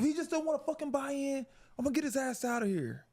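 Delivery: very high-pitched retold locker-room rant; mock yelling. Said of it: "you kinda can hear people yelling".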